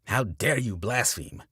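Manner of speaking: loud, slow snarl; snarling preacher. A narrator's reading. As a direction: spit out the outrage slowly. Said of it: "Carey snarls".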